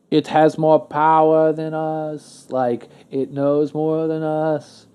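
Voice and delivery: slow, drawn-out put-on royal English accent; King Charles. Retelling the king's talk about AI, slow English accent. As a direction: stretch each word in an imitated English accent. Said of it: "I don't know why I'm giving an accent".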